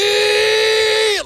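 high, drawn-out goal-commentator shout; Andres Cantor shout. Retold or imitated. Radio host imitates the commentator for listeners, long high shout. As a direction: belt it out and hold the last vowel high. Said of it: "He gives you that".

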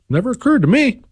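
high, mock-innocent squeak of a voice; sarcastic innocence. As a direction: pretend you never thought of it. Sarcastic denial.

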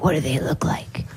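low breathy threat; menacing whisper. Retold or imitated. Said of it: "And he's like".

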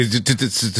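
imitated stutter on a repeated T; mock stutter. Host mimics the schoolboy who struggled at prayer, mock stuttering. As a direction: stammer on T, mimicking a struggling speaker.

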